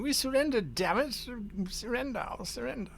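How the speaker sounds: high-pitched shout held back in volume; mock shouting. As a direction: plead surrender in a high, fading mock yell. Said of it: "He's busy shouting".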